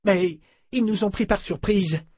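put-on a wounded young girl; hurt girl. A narrator's reading. Beata protests. (Beata proteste, blessée)

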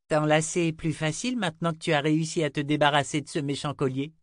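put-on quick, high child's voice; high girlish question, rapid; little girl Rachel, affectionate.

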